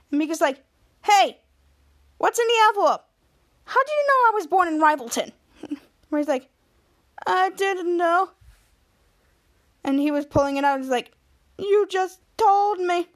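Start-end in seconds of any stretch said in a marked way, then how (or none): 1.0-5.3 suspicious. Retold or imitated. Mika suspicious, calling out. 7.3-8.3 smug. Retold or imitated. Ray smug reveal. 11.6-13.1 smug. Retold or imitated. Ray smug gotcha.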